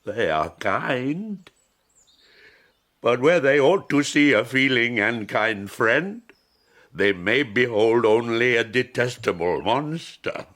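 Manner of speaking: ache over how they will see you; bitter lament. Acted. The creature laments to the blind man in rising anguish.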